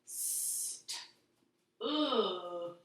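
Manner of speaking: st then ood, split and stretched; whispered st, then voiced ood; sounding out stood. Shown as a demonstration. Teacher segmenting stood for children. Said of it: "Second word is stood".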